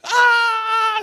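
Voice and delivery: high, loud mock scream; freaked-out scream. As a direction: imitate a terrified sister shrieking. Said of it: "She starts freaking out".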